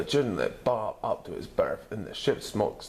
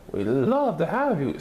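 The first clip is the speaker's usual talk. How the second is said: higher, sweet mother voice; welcoming mother; 8 semitones higher, 4 dB louder than usual. A narrator's reading. Mother welcoming the dinosaur home, sweetly. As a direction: invite him in like a kind mom. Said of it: "said Missus Lizardo".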